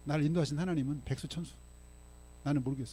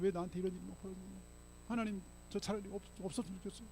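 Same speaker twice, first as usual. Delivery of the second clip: soft, hopeless mumble as Job; acted despair; 10 dB quieter than usual. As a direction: lament to God under your breath, lightly acted. Acts Job despairing before God.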